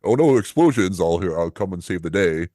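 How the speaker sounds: high, loud fake surprise at disaster; hammed-up shock turning to would-be hero; mock alarm. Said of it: "So he was like".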